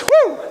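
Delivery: very high, short "woo"; mock-triumphant squeal of a whoop; celebratory whoop. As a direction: cheer your own trophy with a high whoop. Mock celebration of his own trophy.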